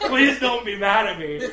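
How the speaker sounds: high, loud, laughing plea; mock pleading. Box guy begging coworker's forgiveness, mock pleading. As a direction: beg forgiveness through a laugh. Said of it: "to make it up to her".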